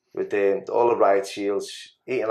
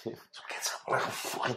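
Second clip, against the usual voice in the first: whispering; 2 semitones higher, 11 dB quieter than usual. Retold or imitated. Retelling the climb onto the roof.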